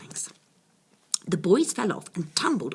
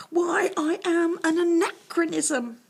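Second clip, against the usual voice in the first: high, loud, tearful dragon voice; crying dragon; 12 semitones higher, 5 dB louder than usual. A narrator's reading. Weeping dragon explains himself to the knights. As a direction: sob out the big word like a sad beast. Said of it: "The dragon kept crying".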